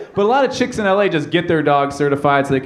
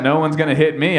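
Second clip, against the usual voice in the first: ordinary delivery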